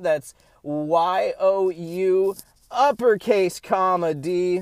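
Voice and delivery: high, loud show-host sign-off; booming outro host voice; podcast outro announcer. Acted. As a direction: wrap up the show like an announcer to the audience.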